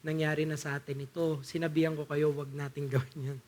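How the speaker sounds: soft, quiet and calm, no blame; gently reminding, not scolding; calm reminder. Retold or imitated. Paul quietly reminding the frightened crew, calm and soft. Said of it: "Ganito ang pagkakasabi niya dyan".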